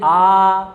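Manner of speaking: "आ" as a long, sustained aa; demonstrated vowel. Shown as a demonstration. Teacher modelling it for students, demonstrated vowel. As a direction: hold "आ" on one level note. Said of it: "आ बोलिए".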